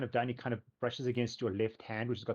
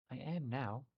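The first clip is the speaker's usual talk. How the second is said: sighing creature; 3 semitones higher, 4 dB quieter, slower than usual. Acted. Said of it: "gives, like, a heavy sigh".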